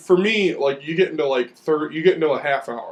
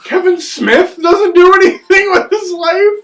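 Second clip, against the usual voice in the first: mock outrage; 12 semitones higher, 7 dB louder, slower than usual. Real, not acted. Mock-indignant defence of Kevin Smith.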